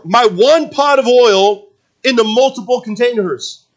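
high, loud protesting questions; incredulous widow. Acted. Preacher voices widow doubting Elisha's instructions, incredulous.

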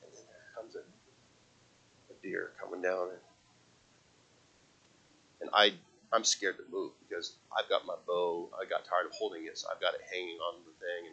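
very soft, low near-murmur; stealthy, tense storytelling hush; hushed hunter's account. Retold or imitated. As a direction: barely voice it, as if a deer might hear. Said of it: "I'm quiet as I can be".

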